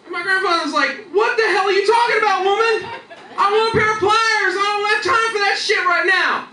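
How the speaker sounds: high, loud, exasperated shouting, laughing; the old man blows his top; angry yelling. Retold or imitated. Grandfather yells at his mishearing wife, furious shout.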